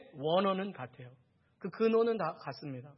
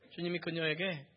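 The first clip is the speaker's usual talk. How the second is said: ordinary talk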